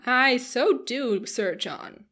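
slow, loud matron's exclamation; drawn-out, emphatic urging; urging cry. A narrator's reading. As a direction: egg him on heartily. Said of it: "cried Missus Jennings".

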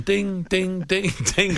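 high sing-song ding ding; imitated ding. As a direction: mimic the phone bells chiming. Imitating phone line bells.

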